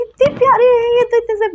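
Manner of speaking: put-on high, gushing little-girl delight; cooing in delight. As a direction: coo over how adorable he is. Little sister cooing at Baba idol. (बहुत ऊँची, प्यार से उमड़ती आवाज़)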